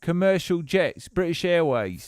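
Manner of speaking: light put-on Nottinghamshire accent; a hint of an English bloke from Nottinghamshire. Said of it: "talking like this".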